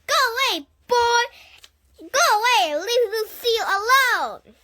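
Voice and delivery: high, loud shooing yell; angry shout. A narrator's reading. Grace yelling at the boy to leave Lucille, high and loud. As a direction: chase him off fiercely.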